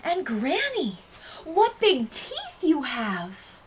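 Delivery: high, drawn-out wonder; amazed child. A narrator's reading. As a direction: stretch it out slowly in high-pitched astonishment. Child amazed at changed grandmother.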